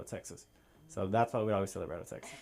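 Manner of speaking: ordinary delivery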